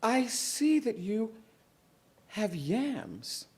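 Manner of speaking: high, sugary coaxing voice; butter up the gum baby for yams; sweet-talking Moesha. Acted. Moesha coaxing the gum baby, sweetly.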